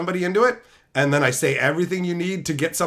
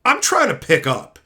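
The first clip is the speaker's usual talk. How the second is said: high, loud mocking echo; mocking mimicry; 7 semitones higher, 4 dB louder than usual. Echoing the manager to mock her, high mocking. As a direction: throw her words back at her. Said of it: "I'm like".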